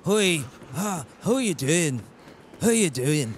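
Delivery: put-on trying out a Scottish accent; amateur Scottish accent, feeling for the sound; Scottish accent attempt. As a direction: rehearse a greeting in put-on Scottish. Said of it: "that's not Scottish".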